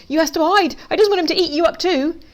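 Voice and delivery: high, urgent plea; panicked warning. A narrator's reading. Pig urging Duck to hide from Fox, panicked. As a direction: pitch it high with worry.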